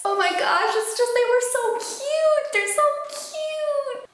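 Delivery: high-pitched gushing squeal, slowing down; squealing over cute couple. Real, not acted. Book reviewer gushing to viewers about the couple, squealing high. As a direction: squeal it out, melting over them.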